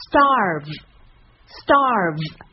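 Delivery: "starve" stretched very slowly, the v biting the lip. Shown as a demonstration. Teacher drills starve very slowly for practice. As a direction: drag out "starve" so every sound shows. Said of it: "这个音只需要多加练习".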